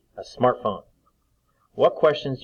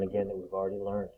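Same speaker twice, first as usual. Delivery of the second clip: usual speaking voice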